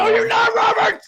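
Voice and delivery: high loud shout of a name; yell. Real, not acted. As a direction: shout the name across the room. Host yelling at guest.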